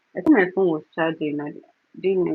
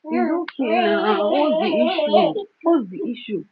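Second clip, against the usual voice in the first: slow soothing; 9 semitones higher than usual. Real, not acted. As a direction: draw it out gently to calm her. Soothing crying baby.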